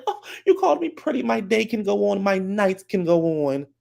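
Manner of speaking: very high, put-on flattered voice; mock delight. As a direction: imitate someone swooning over a compliment, way up high. Mimics woman reacting to compliment.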